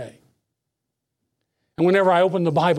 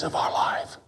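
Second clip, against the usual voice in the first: hushed whispered promise; a preacher's sudden hush; 7 dB quieter, slower than usual. Real, not acted. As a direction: lean in and whisper it.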